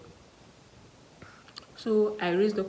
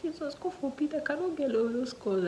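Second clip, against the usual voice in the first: high, pleading prayer to God; tearful high begging; desperate plea; 9 semitones higher than usual. Retold or imitated.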